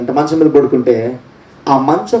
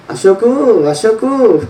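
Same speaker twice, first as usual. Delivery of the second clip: lightly voiced squawking parrot; a hint of a parrot calling a name; 5 semitones higher, much slower than usual. Preacher imitating the pet parrot calling Ashok, lightly. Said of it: "అది అరిసేది".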